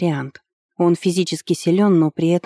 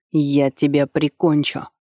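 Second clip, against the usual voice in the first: usual speaking voice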